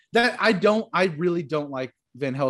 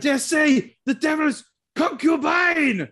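hammed-up vampire hunter; 10 semitones higher, 3 dB louder than usual. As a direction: declaim it like a mad old-film professor. Acting out the vampire hunter's lines.

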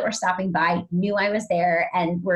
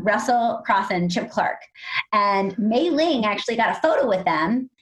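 ordinary talk, nothing marked

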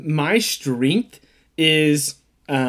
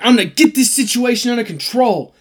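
mock stern take-charge; 7 semitones higher, 3 dB louder than usual. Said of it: "I'm like".

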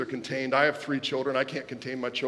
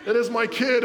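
retold breathless reply; 6 semitones higher, 3 dB louder than usual. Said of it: "I'm out of breath".

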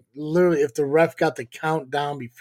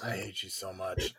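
muttered; 8 semitones lower, 14 dB quieter than usual. Pretend. Joking hate, aside.

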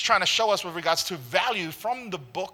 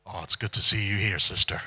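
much lower, smooth Australian charm; dropped voice; 8 semitones lower than usual. Retold or imitated. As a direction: drop the voice to woo a girl. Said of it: "the voice just drops a little bit".

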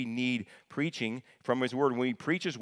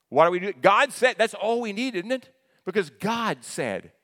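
much higher and louder, ringing out; preaching at full force; 8 semitones higher, 6 dB louder than usual. Real, not acted. Preacher to congregation, loud and rising. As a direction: lift the church with it.

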